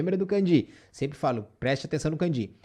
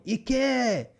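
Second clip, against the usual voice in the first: higher, sharp command: Ike!; barked order; 6 semitones higher than usual. Shown as a demonstration. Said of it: "tá dando uma ordem". (ordem ríspida: Ike!)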